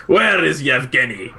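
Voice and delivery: slow, lightly put-on Russian accent; a hint of a bad game Russian accent; mock Russian accent. Mocking a game guard to a friend, mild Russian accent. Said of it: "Russian and French and Australian".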